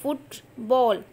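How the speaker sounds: Football drawn out slowly; slow syllable read. Shown as a demonstration. Teacher slowly reading football for students. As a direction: read football slowly, fut then ball. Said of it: "second वाली बॉल".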